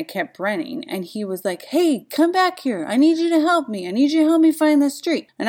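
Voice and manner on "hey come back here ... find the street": higher, louder call after a departing runner. Retold or imitated. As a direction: shout after her, demanding help. Truck driver calls after her.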